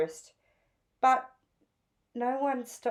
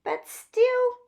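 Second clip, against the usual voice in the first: moaning; 10 semitones higher, 3 dB louder than usual. A narrator's reading. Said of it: "moaned Phoebe".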